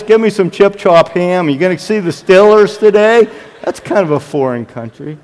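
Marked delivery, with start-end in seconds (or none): 0.0-3.3 loud mock Pittsburgh accent; Pittsburgh-area accent. Pastor mimicking McKees Rocks locals, hammed-up Pittsburgh accent. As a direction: put on a broad western Pennsylvania accent. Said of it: "They sort of talk like this".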